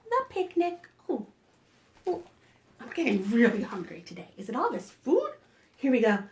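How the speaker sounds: ordinary delivery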